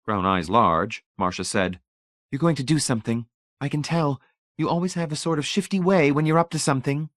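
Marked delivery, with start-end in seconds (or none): 2.3-7.1 higher, anxious wifely voice; wife's higher voice. A narrator's reading. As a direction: press him, she can tell. Wife suspicious, pleading.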